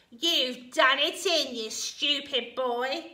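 slow, loud scolding grandma; shouting. A narrator's reading. Grandma blaming George for the sick hen, shouting. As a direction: scold loudly, dragging out each word. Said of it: "Grandma shouted".

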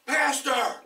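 high, loud call of "Pastor!". Retold or imitated. A big inmate hailing the pastor from across the room, loud. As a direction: shout across the room to get his attention.

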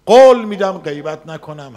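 a sudden loud cry of promise; retold vow to God, called out loud; loud fervent vow. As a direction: burst out with the vow.

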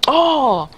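high, loud "oh"; amazed cry. Real, not acted. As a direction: burst out in astonishment. Reacting to a great play.

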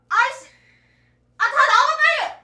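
high loud furious call; angry yell. Acted. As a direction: yell everyone out in anger.

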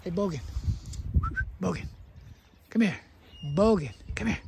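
loud calls across the yard; real calls to a wandering dog; calling the dog. Real, not acted. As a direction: shout for the dog to come.

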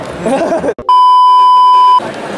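high squealing burst of laughter; loud high cackle at the joke; shrieking laugh. Real, not acted. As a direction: let out a piercing squeal of a laugh.

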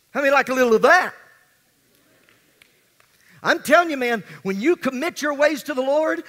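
rally the congregation, building to a shout; preaching at full force. Acted. Preaching crescendo.

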